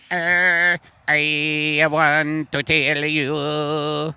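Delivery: put-on slow Italian alien accent. An Italian alien slowly addresses the coach in Italian accent. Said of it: "You're not an Italian alien".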